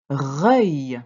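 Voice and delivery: loud, drawn-out dictated syllable; dictation. Shown as a demonstration. Teacher dictating the first syllable to a pupil, loud and drawn out. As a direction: call the syllable out for writing down. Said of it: "On y va pour la première syllabe".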